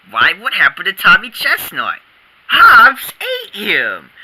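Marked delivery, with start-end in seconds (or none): 2.5-4.1 slow, proud high boast; gleeful boast. Acted. Boy tells the kids his tiger ate the bully, slow and gleeful. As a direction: savor the punchline.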